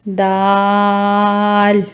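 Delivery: दाल held long for three seconds; three-second daal. Shown as a demonstration. Teacher holds दाल for three seconds. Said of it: "इसको तीन second लंबा करना है".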